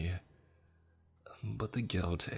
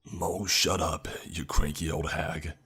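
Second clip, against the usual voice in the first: booming father. A narrator's reading. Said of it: "My father's voice boomed back".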